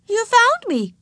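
put-on high child voice; little girl pointing out. A narrator's reading. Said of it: "Ramona pointed out".

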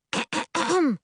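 high-pitched acted throat clearing; prim ahem before reciting. A narrator's reading. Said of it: "Miri clears her throat again".

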